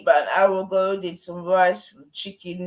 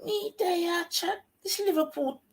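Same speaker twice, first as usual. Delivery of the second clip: very high, small squealing voice; squeaky dismay; 4 dB quieter than usual. Real, not acted. Squeals on about today's match.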